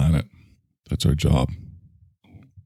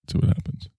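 whisper; 6 dB quieter, faster than usual. Acted. Whispered aside before drinking.

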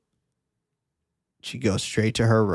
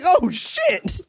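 mock shock. Voicing the cured woman.

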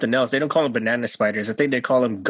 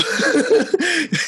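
bright high burst of laughter. Real, not acted. Laughing after the owl story.

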